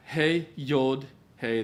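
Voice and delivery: slow, spelled-out Hey Yod Hey; Hebrew letter names. Shown as a demonstration. Teacher spelling out Hebrew letters, slow and deliberate. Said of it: "the top one is".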